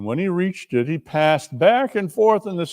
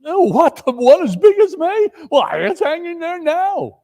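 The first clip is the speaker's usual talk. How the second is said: high, lightly voiced lad; a hint of a boy's voice; astonished boy; 12 semitones higher, 4 dB louder than usual. A narrator's reading. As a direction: marvel at the big turkey. Boy answers about turkey.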